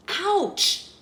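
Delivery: high, sharp "ouch"; pained ouch. A narrator's reading. Goldilocks climbs into the great big bed, pained yelp.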